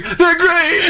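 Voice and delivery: very high strained mascot cry; hammed-up high cartoon tiger; climactic catchphrase. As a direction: squeal the slogan at a mock climax. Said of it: "like, finishing and going".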